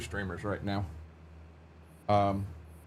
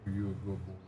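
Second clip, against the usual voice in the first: soft cooing pet voice, much quieter; talking to a dog; 2 semitones lower, 9 dB quieter, much faster than usual. Real, not acted. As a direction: ask the dog sweetly and softly. Soft talk to his dog.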